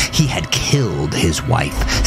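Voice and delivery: plain reading voice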